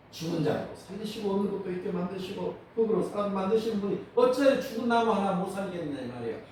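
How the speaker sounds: ordinary talk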